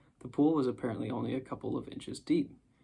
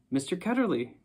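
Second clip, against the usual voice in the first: higher and a bit louder, calling out; shouted name; 6 semitones higher, 4 dB louder than usual. A narrator's reading. Girl remembering at last, shouting the name out. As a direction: blurt the name in sudden recognition. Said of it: "she shouted out".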